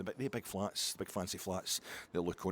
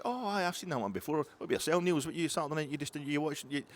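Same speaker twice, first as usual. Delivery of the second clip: high mock Belgian voice on the phone; Belgian caller; 6 semitones higher than usual. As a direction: play Philippe high and chirpy. Philippe voice continues.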